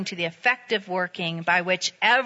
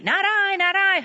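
high sing-song chorus of refusals; storyteller's high animal voices saying no; animals refusing; 9 semitones higher than usual. A narrator's reading. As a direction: chant it up high like barnyard animals.

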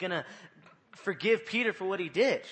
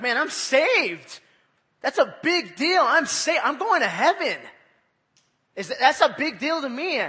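much higher, much louder joyful shout; overjoyed believer; 8 semitones higher, 11 dB louder than usual. Retold or imitated. Preacher acting a believer thrilled to be saved, shouting. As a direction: burst out with the thrill of being saved. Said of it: "You're like".

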